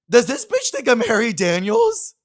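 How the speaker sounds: high, loud, slow incredulous shriek; outraged disbelief. Real, not acted. Realizing the fan mistook him for another singer, shrieking disbelief. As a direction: scream it in mock outrage. Indignant realization of mistaken identity.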